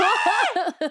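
high-pitched yelp through laughter; imitated panicked scream on a plane; mock scream. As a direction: shriek in mock terror. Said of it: "o sea, yo grito".

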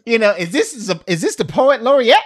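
high, fast amazed question; impressed awe. Retold or imitated. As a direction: gush like you're awestruck. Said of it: "you'd be like".

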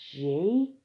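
drawn-out guess, rising high; retold unsure question, lifted upward; tentative guess. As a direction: imitate someone guessing with little confidence. Retells man's second wrong guess.

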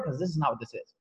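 ordinary delivery

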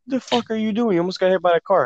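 high-pitched, alarmed telling-off; acted scolding. Friend who pulled him back from a car, scolding.

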